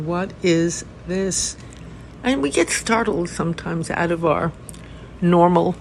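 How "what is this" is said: very slow, settled question, let it hang; deliberate meditative inquiry; slow koan. Shown as a demonstration. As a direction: offer the koan with stillness. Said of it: "primary and beautiful Zen koan".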